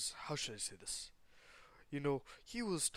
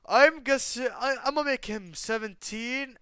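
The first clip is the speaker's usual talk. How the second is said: shout; 9 semitones higher, 10 dB louder than usual. Real, not acted. Shouting reply to chat.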